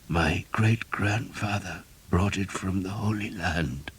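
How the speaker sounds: quiet and slow, fading strength; slow, soft dying voice. A narrator's reading. Dying priest speaks.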